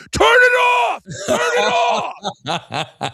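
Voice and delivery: screaming at the repeated song, laughing; retold scream. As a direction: shout it off over the music. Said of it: "I just scream".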